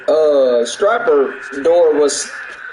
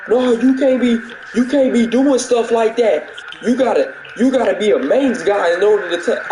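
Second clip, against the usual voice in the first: mock lecturing coworker; 8 semitones higher than usual. Imitating a coworker telling him only a main guy may touch it, high and mocking. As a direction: parrot the rule back in a silly high voice. Coworker's rule mocked in retelling.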